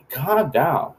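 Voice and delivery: high-pitched mom impression; imitated mom. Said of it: "She's like".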